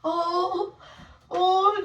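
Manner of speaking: ordinary talk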